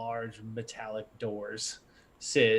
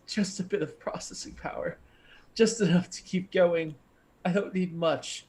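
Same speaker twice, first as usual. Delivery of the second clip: begging; 7 semitones higher, 4 dB louder than usual. Acted. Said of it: "a voice begging".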